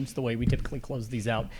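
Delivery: normal talking voice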